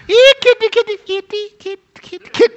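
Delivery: very high kitty call, fading off; coaxing cat call. Acted. Owner calling kitty who won't come, high coaxing.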